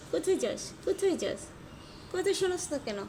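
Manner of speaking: exasperated owner scolding; scolding a pet. Real, not acted.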